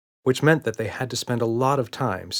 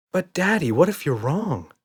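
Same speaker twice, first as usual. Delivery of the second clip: put-on higher pleading child's voice; little girl; 8 semitones higher than usual. A narrator's reading. Emma pleads with Daddy at bedtime, childish voice.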